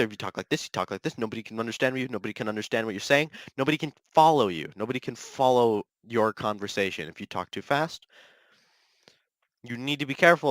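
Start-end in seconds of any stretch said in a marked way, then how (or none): 0.0-8.1 talking too fast. Shown as a demonstration. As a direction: rattle it off so nobody can follow. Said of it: "you can't talk too fast either".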